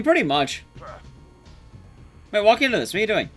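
ordinary talk

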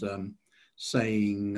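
ordinary talk

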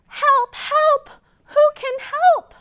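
high, loud little duck crying help; duck calling for help. Acted. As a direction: squeak out a panicky call for help.

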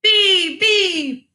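high, loud call; controlled high shout, teacher demonstrating. Shown as a demonstration. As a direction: shout it without screaming. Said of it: "shouting would be".

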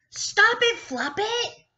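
high, loud cranky cow voice; cross cow telling off Floppet; grumbling cow. Acted. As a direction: snap at the bunny as a busy mother cow. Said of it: "grumbled Cow".

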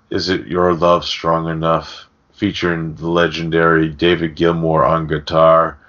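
normal talking voice

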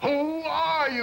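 high, slow, drawn-out challenge; indignant demand. Acted. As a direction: stretch each word, demanding an answer. Husband demands answer of intruders.

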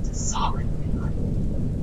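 soft, slow whispered remark; whispering. Real, not acted. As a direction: breathe it out quietly. Quiet remark after surviving.

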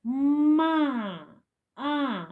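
"ма" with the А held long, then А alone; picking out next sound. Shown as a demonstration. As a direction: stretch the vowel in "ма" and lift it. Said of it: "Наступний звук".

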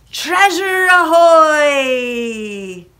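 long, high, loud drawn-out hail; joyful holler. A narrator's reading. Jack announces treasure to the lads, joyful long holler. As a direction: stretch the last word out in triumph. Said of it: "Jack hollered with joy".

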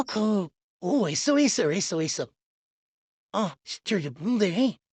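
normal talking voice

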